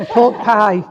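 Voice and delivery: "Pork pie" said through a laugh; laughing repeat. Shown as a demonstration. Laughing at her own Rotherham accent to the sitter, amused. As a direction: chuckle as you say "Pork pie" again. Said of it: "Oh, yeah, you translate".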